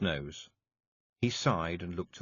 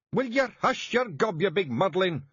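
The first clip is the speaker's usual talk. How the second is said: high, loud Scottish roar; shouting; 12 semitones higher, 5 dB louder than usual. A narrator's reading. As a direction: Rob silencing the wailer. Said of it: "shouted Rob Anybody".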